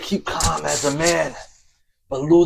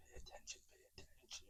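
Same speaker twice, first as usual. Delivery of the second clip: fading whisper; 40 dB quieter, much faster than usual. Acted. Narrator warning viewers, trailing off in a whisper. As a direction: pay attention dying away to a whisper.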